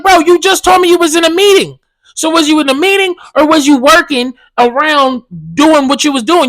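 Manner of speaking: high, loud, exasperated challenge; retold exasperated confrontation. Tenant catching the maintenance man in a lie, exasperated high and loud.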